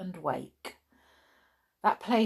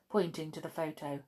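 ordinary delivery, nothing marked

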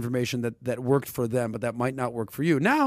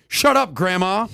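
mock angry; 4 semitones higher, 6 dB louder, slower than usual. Mock scolding grandma.

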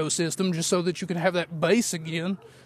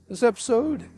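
voice cracking; 5 semitones higher than usual. Real, not acted. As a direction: voice cracks on a high note. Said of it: "pretend like you didn't hear my voice crack".

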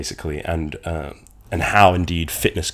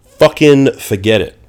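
slow, exasperated snap, higher and louder; angry; 6 semitones higher, 8 dB louder, slower than usual. Acted. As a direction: spit it out in disgust. Dramatized giving up.

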